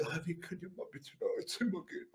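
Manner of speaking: very soft mumbled imitation; mock meek voice, barely audible; feeble guard. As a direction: mimic a timid, ineffectual request. Mimics feeble security guard.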